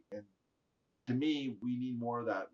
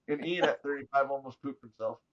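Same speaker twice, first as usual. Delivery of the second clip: ordinary talk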